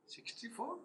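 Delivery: high, surprised rising question; a husband baffled by the number; puzzled husband. Retold or imitated. Husband puzzled by sixty-four teeth.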